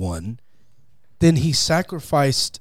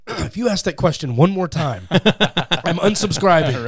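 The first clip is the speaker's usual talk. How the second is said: mock listener threat; 5 semitones higher, 4 dB louder than usual. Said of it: "I hear from other people going".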